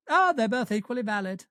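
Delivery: lightly mocking know-it-all answer; imagined answer. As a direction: toss it off like a smug search result. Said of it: "I will Google that later".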